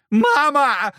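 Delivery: high, loud panicked scream; terrified yell. Acted. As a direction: yell like a scared demon. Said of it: "заорал чёрт".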